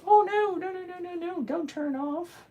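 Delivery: very high, thin pleading voice; high-pitched mock panic at a dying gadget; panicked squeal. Real, not acted. Pleads as device powers off.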